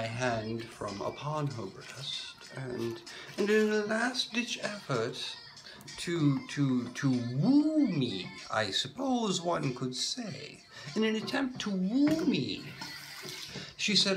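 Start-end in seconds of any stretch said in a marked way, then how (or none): none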